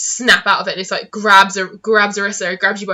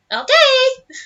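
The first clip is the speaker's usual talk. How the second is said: high tiny critter voice; pixie; 4 dB louder than usual. Acted. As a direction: chirp it like a small creature. Tiny creature voice.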